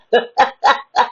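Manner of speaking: quick real laugh; short laugh. Real, not acted. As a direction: laugh at yourself. Laughing at own story.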